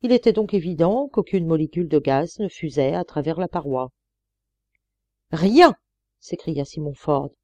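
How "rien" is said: a loud, high outburst of anger; angry cry. A narrator's reading. Old miner finding no gas, crying out in anger. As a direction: spit out the word in frustration. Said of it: "s'écria ... colère".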